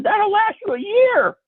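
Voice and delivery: very high, slow comic disbelief; incredulous retort. Retold or imitated. Teller answering friend about huge syrup jug, acted incredulity. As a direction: stretch it out high in mock amazement.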